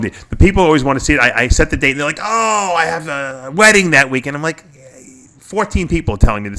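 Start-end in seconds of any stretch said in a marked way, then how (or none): 2.2-4.4 high, loud mock lament; mock complaint. People tell the seminar host about clashes, mock complaint. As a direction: imitate a whining excuse with a drawn-out high "oh". Mimics people objecting to his date.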